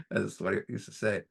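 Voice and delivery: ordinary talk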